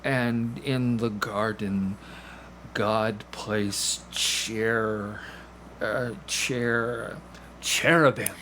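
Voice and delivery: slow halting creature, stumbling over cherubim; creature reading haltingly. Acted. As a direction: sound out each word like it's your first. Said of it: "the creature is like".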